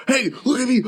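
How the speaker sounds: high, goofy mock voice; mocking attention-seeker. Mocking a girl dancing to distract.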